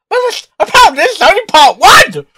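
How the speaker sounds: loud, high, thrilled disbelief; excited outburst. Real, not acted. Reacting to the fanfic reading, loud excited disbelief. Shocked the story continues further.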